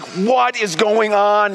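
high, drawn-out disbelief; mock panic. Dad panicking as the ride repeats, hammed-up alarm. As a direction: stretch each word in comic alarm.

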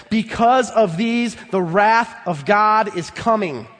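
slow, weighty, every word leaned on; slow solemn warning. Acted. Preacher warning the church of wrath, slow and grave. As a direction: let the warning land slowly. Preacher reads verse six slowly.

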